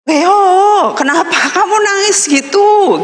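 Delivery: high scolding voice of a father; Korean father scolding. Retold or imitated. Korean foster father scolding his crying daughter, high chiding voice. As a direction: scold her like a worried dad. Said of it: "ayah Korea saya bilang".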